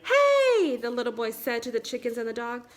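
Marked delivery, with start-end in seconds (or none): 0.0-0.8 joyful shout. Acted. Boy calls out joyfully.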